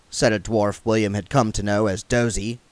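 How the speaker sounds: ordinary delivery, nothing marked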